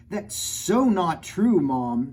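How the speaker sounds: a hint of an indignant sister. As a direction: protest to Mom, indignant. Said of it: "Susie said".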